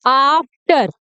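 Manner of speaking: आफ्टर slow, stretching the aa; drawn-out demo. Shown as a demonstration. Teacher slowing down to show the aa sound. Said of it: "फिर मैंने आफ्टर बोला".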